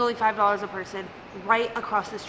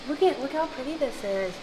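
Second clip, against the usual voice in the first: usual speaking voice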